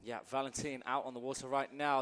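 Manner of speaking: ordinary talk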